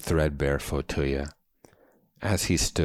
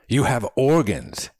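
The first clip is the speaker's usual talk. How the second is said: loud, high triumphant announcement; proclaiming; 9 semitones higher, 7 dB louder than usual. A narrator's reading. Older man seizes on companion's answer, proclaiming. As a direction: proclaim it, voice leaping up. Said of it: "he proclaimed".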